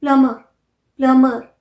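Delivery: प्लम्बर said as "plummer", loud; silent B. Shown as a demonstration. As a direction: model प्लम्बर with only M in the middle. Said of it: "ब silent है".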